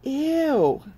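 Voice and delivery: pitchy disgusted ew then gross. Acted. Salma reacting to Lily's sandwich, disgusted. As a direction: turn your nose up.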